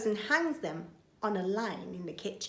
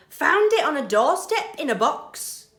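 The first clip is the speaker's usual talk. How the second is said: proud burglar; 6 semitones higher, 8 dB louder than usual. Acted. Bill explains to Betty.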